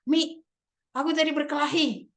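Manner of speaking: put-on high little boy's confession; boy admitting a fight to mum; child's voice. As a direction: pitch up like a young son. Son confesses fight to mother.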